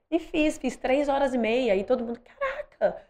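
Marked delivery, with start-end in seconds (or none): 2.2-3.0 much higher mock amazed cry. Friends amazed at her first marathon time, high exclamation. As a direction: imitate friends gasping at the result.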